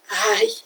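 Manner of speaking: a high, soft sigh of relief at day's end; mock tired sigh. Quoting someone at bedtime with a high soft sigh of relief. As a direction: sigh it out like flopping into bed.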